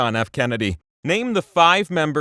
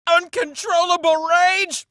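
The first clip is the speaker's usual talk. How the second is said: mock rage; 14 semitones higher than usual. Hinting at wrath in voice.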